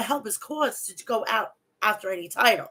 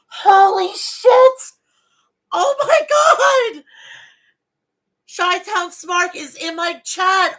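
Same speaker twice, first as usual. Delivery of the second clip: overwhelmed high-pitched shout, drawn out; freaking out; 8 semitones higher, 8 dB louder, slower than usual. Real, not acted. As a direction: cry out slowly in real joyful shock, near tears. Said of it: "I'm, like, freaking out right now".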